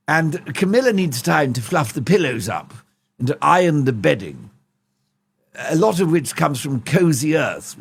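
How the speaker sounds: posh fussy king in a voice note, each you see trailing low; King Charles impression. King leaving Harry a voice note, posh and fussy.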